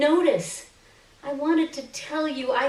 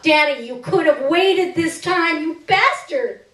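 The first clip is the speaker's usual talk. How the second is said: louder, higher pitched bitter cry; angry outburst; 6 semitones higher, 4 dB louder than usual. Acted. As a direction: lash out at the father who left. Outburst at absent father.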